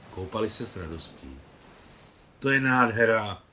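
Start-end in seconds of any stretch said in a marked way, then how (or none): 2.4-3.4 loud, delighted cry; loud exclamation. A narrator's reading. Nicole bathing with the horses at sunset, loud delighted cry. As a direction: call out in joy across the water. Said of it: "hlasitě vykřikla Nicole".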